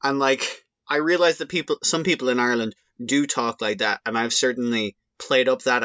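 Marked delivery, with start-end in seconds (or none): none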